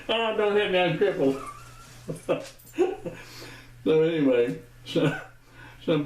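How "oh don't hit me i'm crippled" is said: high, hammed-up cripple plea; mock pleading. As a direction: pretend to cower from a blow, joking.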